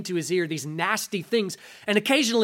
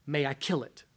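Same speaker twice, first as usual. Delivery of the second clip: stern angel voice; 5 semitones lower, 7 dB quieter than usual. Acted. Angel repeats.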